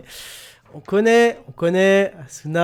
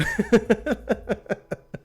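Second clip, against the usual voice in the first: high, rippling laugh; helpless run of high chuckles; long laugh; 5 semitones higher than usual. Real, not acted. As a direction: crack up at your own joke. Cracks up at own joke.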